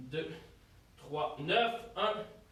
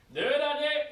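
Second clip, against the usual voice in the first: encouraging shout; 6 semitones higher, 4 dB louder, slower than usual. Real, not acted. Coach pushing the final reps.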